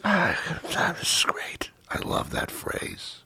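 breathy unvoiced whisper; slow, soft, intimate whisper. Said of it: "And I say".

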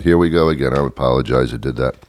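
ordinary talk, nothing marked

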